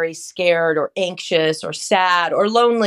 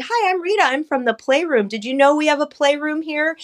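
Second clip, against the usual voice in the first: put-on high, sing-song greeting to a child; bright, high child-friendly voice; 8 semitones higher than usual. Shown as a demonstration. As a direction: lift the pitch way up, playful and inviting. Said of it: "it's all in the way that we kind of introduce ourselves".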